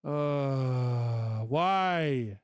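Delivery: fed-up cry at the screen; exasperated outburst. Real, not acted. Plugin fails again, exasperated.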